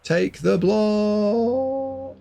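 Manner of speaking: high, hugely stretched wail; drawn-out plea. Real, not acted. Commentator begging the player to block, drawn-out plea. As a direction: plead it high, holding the last word very long.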